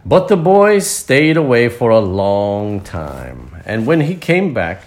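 slow, stretched storybook reading; story narration. A narrator's reading. Narrator tells children of the happy tree, slow storytelling voice. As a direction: draw out the phrases for listening children.

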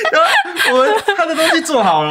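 high, loud words squeezed out through laughter; laughing while talking. Real, not acted. Recalling the fugitive's order with a friend, high laughing voice.

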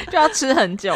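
laughing. Real, not acted. Laughing at the cat's long meal.

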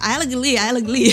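a light, playful Indian English impression; Indian English accent. To the audience, mimicking an Indian colleague, Indian English accent. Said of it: "就大致是".

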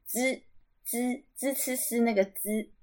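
"Z" as Mandarin "zi", drawn out slowly. Shown as a demonstration. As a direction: say "Z" like the first of zi, ci, si. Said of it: "英文字母C是念Z". (“Z”念成“资”，慢慢示范)

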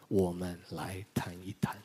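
whispered gentle invitation; soft whisper. Retold or imitated. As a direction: whisper it like a kind offer to talk. (轻声耳语地邀请)

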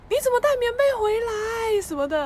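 badly faked surprise. Mom pretending surprise at the quilt, hammed-up. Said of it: "我妈还故意演".

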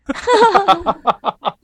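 a big high-pitched burst of laughter; loud high laugh. Real, not acted. (高声大笑)

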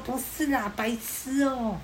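put-on high, slow, playfully scolding girl; coy girlish scolding. Girl on the phone playfully brushing off the caller's suspicion.

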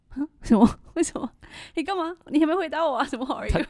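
high, loud, confused protest breaking into a laugh; retold outrage at a greeting thrown back unanswered; baffled disbelief. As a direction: replay your own bewildered reaction, half laughing. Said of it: "然后我想说".

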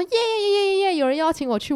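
high squealing yay yay yay; gleeful cheering. Retold or imitated. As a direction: cheer like a giddy girl who got invited. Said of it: "Lydia就欢天喜地".

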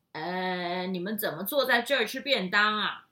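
loud, questioning train conductor. Acted. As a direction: boom it out like a conductor. (大声的列车长)

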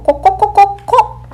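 高声学鸡叫ここここ; 模仿公鸡咯咯叫; rooster clucking. Acted. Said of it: "公鸡在叫". (学公鸡叫)

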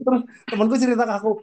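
ordinary talk

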